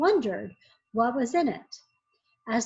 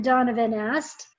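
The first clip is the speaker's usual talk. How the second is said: plain reading voice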